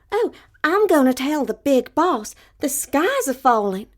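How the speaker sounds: high, loud, breathless hen; alarmed little hen. Acted. Little hen telling the rooster the sky falls, high and loud.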